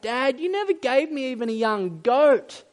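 acted hurt complaint; complaining son. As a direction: whine it at Dad like a resentful son. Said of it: "You hear the son's complaint".

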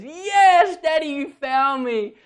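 put-on high, loud childlike cry of delight; excited little girl. Dad voices his daughter jumping out at him, high and thrilled. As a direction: pop out and squeal like a found child.

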